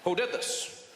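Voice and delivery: halting, emphatic presidential impression; tough-talking statesman with long hesitations; Barack Obama. President vowing to find out who did this, stern halting delivery. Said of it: "Barack Obama, he was there, he's like".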